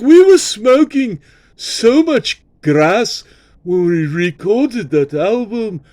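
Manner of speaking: put-on high, slow British accent for Paul; Paul impression. Host quotes [name] about the album, high British accent. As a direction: drawl it out like a stoned Beatle. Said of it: "And Paul's like".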